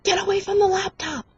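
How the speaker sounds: high-pitched mock mom order; scolding mom. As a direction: imitate an exasperated mother scolding.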